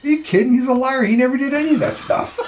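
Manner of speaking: high, loud, half-laughing scoff; scoffing farmer. Retold or imitated. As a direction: toss off the punchline with a laugh. Farmer's punchline in the joke.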